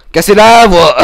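loud, strained and forced. Acted. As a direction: push it until the voice cracks. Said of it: "ça nique la voix".

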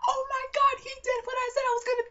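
very high, loud squealing reenactment; re-enacted shriek at the TV; excited yelling. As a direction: scream it out in thrilled disbelief. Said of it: "me and my sister were yelling".

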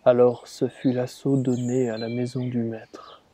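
ordinary delivery

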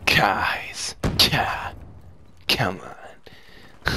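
breathy, defeated near-whisper; deflated whisper. Real, not acted. Player to guys after dying, whispered exasperation. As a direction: plead weakly with barely any voice.

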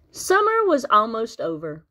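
ordinary delivery

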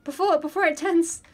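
high, loud hurried call; urgent calling out. Real, not acted. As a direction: shout it to a friend with no time left. Urgently calling streamer to take raid.

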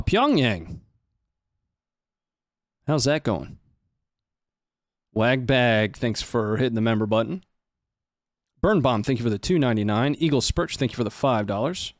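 ordinary talk

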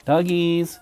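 loud high call for the dogs; calling the dogs home. Real, not acted.

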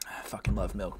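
unvoiced, hushed whisper; a soft private whisper. Real, not acted. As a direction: breathe it out without voice. Cooling mouth with milk.

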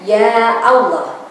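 firm, rhythmic prayer recitation; recited prayer. Acted. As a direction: intone the prayer for parents steadily. Leads prayer for parents.

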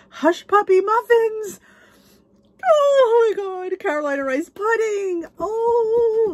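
high, loud delighted exclamations; excited squeal. Real, not acted. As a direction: squeal with delight over the food. Delighted reading out favorite dishes.

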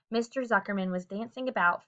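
plain reading voice

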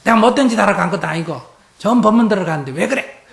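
loud, high, fast protest with a laugh; wife loudly defending herself to her husband, indignant; indignant comeback. Retold or imitated. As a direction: snap back defensively at the husband, hammed up. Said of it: "그때 보통 어떻게 해요?".

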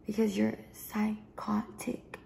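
slow, breathy whispered jab. Real, not acted. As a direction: drop to a slow whisper for the insult. Quiet jab at the ex.

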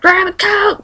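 urgent mom. Retold or imitated. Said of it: "My mom was like".

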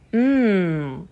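long savoring "mmm"; drawn-out falling mmm; contented hum. A narrator's reading. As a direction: hum it as if tasting something good. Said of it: "said the Duchess".

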